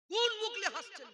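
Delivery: high, loud indignant demand; angry shout. Real, not acted. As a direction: challenge them with outrage. Scolding audience for laughing.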